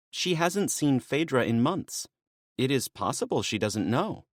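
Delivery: lightly voiced younger woman, teasing; a hint of a sly maenad needling him. Maenad Lalaya teasing Dionysus about Ariadne, lightly voiced.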